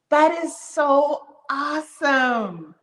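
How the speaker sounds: slow, drawn-out delighted awesome; slow happy gushing. Real, not acted. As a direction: stretch it out with joy.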